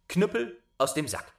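high, ringing command to the cudgel; bright magic summons; commanding call. Acted.

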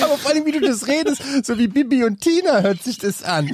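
laughing. Real, not acted. Laughing while mocking reading style.

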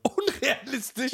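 slow, high, disbelieving question; stretched-out indignant disbelief; incredulous echo. Real, not acted. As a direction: throw the word back, drawn out and high. Challenges the verdict on romance films.

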